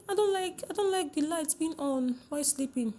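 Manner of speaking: high-pitched spouse's grumble; mock complaint. Spouse objecting to the bedroom lights at night, mock complaint.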